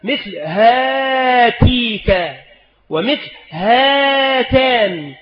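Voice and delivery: very slow, drawn-out example words; slow dictation. Shown as a demonstration. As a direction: spell out هاتيك and هاتان slowly for the class. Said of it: "كتبت". (نطق بطيء جدا لكلمتي هاتيك وهاتان)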